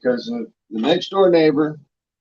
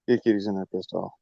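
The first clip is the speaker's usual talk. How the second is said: quiet aside; 6 semitones lower, 6 dB quieter, faster than usual. Real, not acted. Talks softly to someone off mic.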